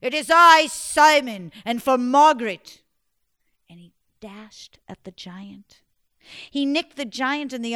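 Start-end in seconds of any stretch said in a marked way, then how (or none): 0.0-2.8 heroic battle cry. Acted. Simon challenging the giant, loud heroic cry. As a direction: shout it proudly, sword raised. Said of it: "held his sword up".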